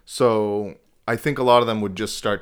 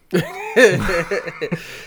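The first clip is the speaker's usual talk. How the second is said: loud high laugh; 10 semitones higher, 5 dB louder than usual. Real, not acted. Laughing hard after the Mainer joke.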